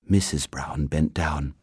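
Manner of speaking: ordinary delivery, nothing marked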